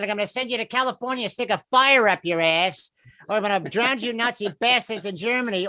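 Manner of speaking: high, menacing mobster threats; Joe Pesci. Acted. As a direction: threaten like a female wiseguy. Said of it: "sound like a female Joe Pesci".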